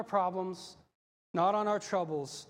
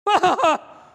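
loud, high burst of hammed-up panic; wordless mock-terrified outcry; mock cry of fear; 11 semitones higher, 15 dB louder than usual. Disciples on the boat crying out, mock fear. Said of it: "they cried out for fear".

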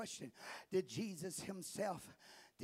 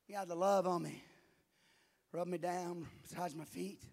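usual speaking voice

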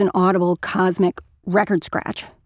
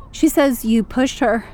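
unsure; 4 semitones higher than usual. Acted. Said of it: "my voice is unsure".

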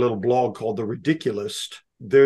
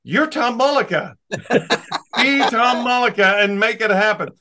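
laughing encouragement; 9 semitones higher, 3 dB louder than usual. Retold or imitated. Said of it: "I go".

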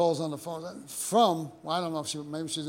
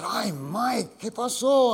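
imitating Arturo; 7 semitones higher, 5 dB louder, much slower than usual. Arturo teasing in Spanish.

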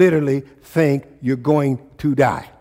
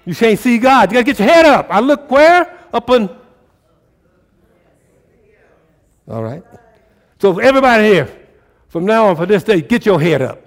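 high, loud, fervent sermon; rising preacher's fire; full-force preaching; 6 semitones higher, 8 dB louder than usual. Acted. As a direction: drive it home to the congregation.

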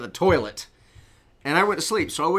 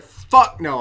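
loud refusal; 4 semitones higher, 11 dB louder than usual. Retold or imitated. Refusing to clean vomit tub.

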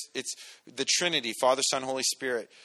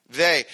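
"they" set apart with a louder hit; stress on they; 7 dB louder than usual. Shown as a demonstration. Preacher contrasting "they" with the church. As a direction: lean on "they", not us. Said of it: "not us or some among you".

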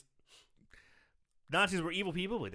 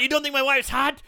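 indignant; 8 semitones higher, 7 dB louder than usual. Retold or imitated. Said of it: "he's like".

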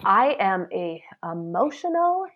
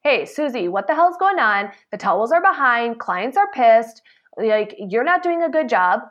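harsh confrontation; 3 semitones higher, 4 dB louder than usual. Shown as a demonstration. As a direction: show how not to confront a coworker.

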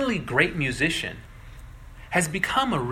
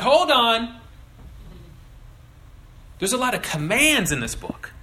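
raised, louder protest at the start; sceptical objector; 6 semitones higher, 4 dB louder than usual. Acted. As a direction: push back like a doubtful listener. Voicing a sceptical listener's objection.

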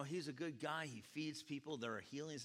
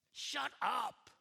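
sharp higher snap from the crowd; crowd snapping; 10 semitones higher, 5 dB louder than usual. Acted. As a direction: shut him down, annoyed crowd. Crowd rebukes blind man.

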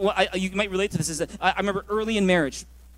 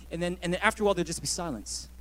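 ordinary delivery

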